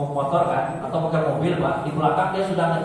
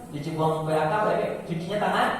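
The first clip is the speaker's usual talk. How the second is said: ordinary talk, nothing marked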